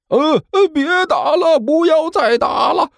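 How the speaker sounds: very high-pitched frantic plea; desperate straining yell. A narrator's reading. Liu Abei begs the two to stop fighting, desperate yell. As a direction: strain at the top of the voice. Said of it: "声嘶力竭的大声吼着".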